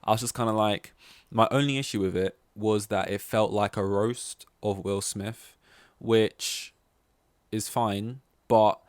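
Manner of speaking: ordinary delivery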